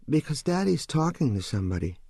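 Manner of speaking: put-on high-pitched little boy voice; young son. Narrator voices small son.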